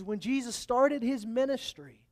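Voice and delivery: ordinary talk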